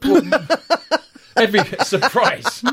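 words broken by laughter; laughing while talking. Real, not acted. Laughing at co-host's joke.

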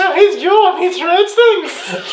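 put-on very high falsetto shop girl, laughing; high squeaky hammed-up maid voice; Cheryl voice. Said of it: "I'm Cheryl".

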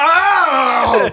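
high, loud mock yelp of pain. Man struck by a train crying out, hammed-up yelp. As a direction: yelp "Ow!" like you just got hit.

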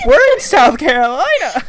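high, loud disbelieving cry; stunned realization, loud and high; shocked. Retold or imitated. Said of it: "I was like".

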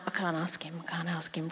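a hushed, frightened whisper. Retold or imitated. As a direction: breathe it out, afraid to ask. Her fearful reply to God.

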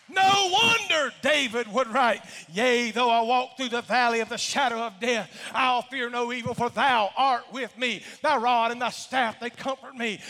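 loud, ringing preacher's reading of the psalm; full-force preaching. Acted.

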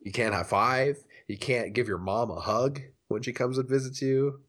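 ordinary talk

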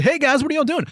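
high sing-song imitation of a kid on rollerblades; mock cheery greeting. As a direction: ham up a bright hey guys.

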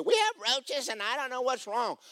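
high, complaining homeowner voice; whiny homeowner. Retold or imitated. Homeowner whining to the maintenance man about roaches, pitched high. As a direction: mimic a fretful woman.

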